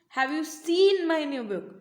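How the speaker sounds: slow question with a long, punched SEEN; stress on SEEN. Shown as a demonstration. Teacher demonstrating how stress changes meaning, stress on SEEN. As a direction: hit and stretch the word seen. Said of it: "repeated with different words".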